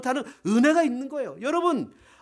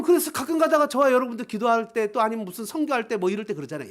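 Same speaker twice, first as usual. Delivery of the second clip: ordinary talk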